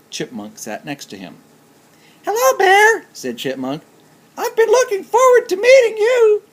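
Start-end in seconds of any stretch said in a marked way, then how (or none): 2.2-3.1 very high, squeaky little chipmunk voice; tiny chipmunk voice. Acted. Chipmunk greeting Bear at the party, very high squeaky voice. 4.4-6.4 very high, squeaky little chipmunk voice; tiny chipmunk voice. Acted. Chipmunk greeting Bear at the party, very high squeaky voice.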